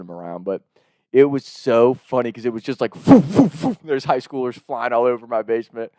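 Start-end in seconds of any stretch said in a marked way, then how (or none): 2.9-3.8 tossing noises. Retold or imitated. Imitating bodies being tossed.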